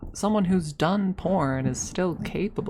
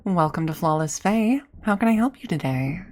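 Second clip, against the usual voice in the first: lilting counter greeting, pitched up at the ends; customer service voice; 3 semitones higher, 3 dB louder than usual. Shown as a demonstration. Worker greeting a customer at the counter, customer service voice. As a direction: do a chirpy scripted fast-food greeting. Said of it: "that's the voice you'd hear".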